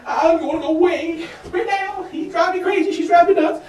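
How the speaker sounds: high-pitched put-upon pet voice; complaining robot dog. Acted. Robot dog fed up with its yelling owners, exasperated. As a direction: whine lightly as the fed-up dog. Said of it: "you have your robot dog going".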